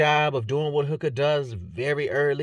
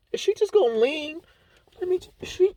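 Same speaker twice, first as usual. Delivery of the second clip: voicing Molly; 14 semitones higher than usual. Voicing Molly mid-fight, thinking.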